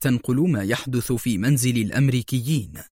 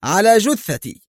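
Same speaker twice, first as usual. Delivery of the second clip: higher, louder defiant shout; police chief refusing his rival, loud defiant cry; defiant outcry; 8 semitones higher, 5 dB louder than usual. A narrator's reading. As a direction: refuse violently. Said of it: "هتف جوزيه".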